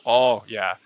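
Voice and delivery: ordinary talk, nothing marked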